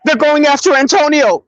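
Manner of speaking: high-pitched retold panic; hysterical caller. Friend warns that Antonio is targeted, mock-hysterical. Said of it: "This motherfucker is hysterical".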